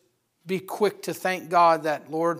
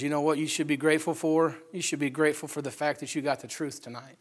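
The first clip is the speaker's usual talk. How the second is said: ordinary talk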